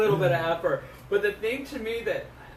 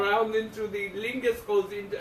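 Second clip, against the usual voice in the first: put-on Indian accent; 4 semitones higher, 7 dB louder, slower than usual. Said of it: "hearing it in that Indian accent".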